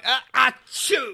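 slow hammed-up achoo; fake sneeze. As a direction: act out a big sneeze. Fake sneeze before handshake.

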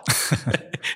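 unvoiced giggle at his own joke; a quick breathy chuckle; breathy laugh. Real, not acted. Joke teller cracks up at his own punchline, breathy laugh.

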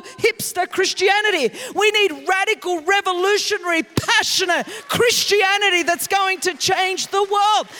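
loud, high, rousing preacher calling for passion; preaching at full force. Acted. Preacher urging the church toward radical Christianity, shouted at full force. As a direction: drive every word home to the crowd.

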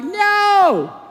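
high, drawn-out cry of refusal; angry Jesus. Retold or imitated. Preacher voices Jesus refusing the temple trade, angry. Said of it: "he's angry".

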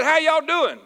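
high-pitched, hearty Southern hello; hearty Southern greeting. Retold or imitated. Preacher greeting a reserved northern Sunday school room, hearty and high. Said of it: "we way different than them folks".

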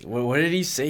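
usual speaking voice

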